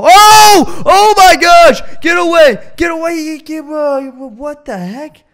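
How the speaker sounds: very high, loud panicked shout; real jump-scare panic; terrified scream. Real, not acted. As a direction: scream as it lunges at you. Monster jumps out, screaming.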